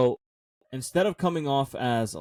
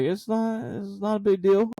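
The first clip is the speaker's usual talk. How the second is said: reassuring worker; 8 semitones higher, slower than usual. Retold or imitated. Employee reassuring him, retold.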